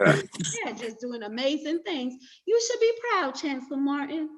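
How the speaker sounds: doting Southern belle praise. Pretend. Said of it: "I'm put on my Southern Belle accent".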